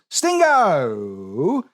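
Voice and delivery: called out with a rising lilt; Tim's catchphrase. Retold or imitated. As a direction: shout it like Tim would. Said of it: "As Tim says".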